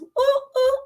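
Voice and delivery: high-pitched excited ooh; mock a go-go-go happy whoop; acted happy hype. Coach mimicking the always-positive mindset, hyped-up happy. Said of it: "happy, go, go, go".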